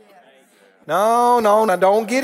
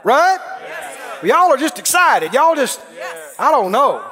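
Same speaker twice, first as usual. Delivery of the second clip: full-force preaching; 3 semitones higher than usual. Acted. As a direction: preach it out with fire.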